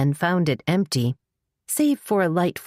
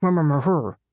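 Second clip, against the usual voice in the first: muffled by a hand. Acted. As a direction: mouth covered, muffled murmur. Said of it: "it came out more like".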